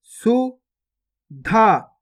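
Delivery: गुफा drawn out very slowly, each sound held long; slow stretched reading. Shown as a demonstration. Teacher showing pupils each sound, drawn out very slowly. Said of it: "पहले तो आपको शब्द को गौर से सुनना है".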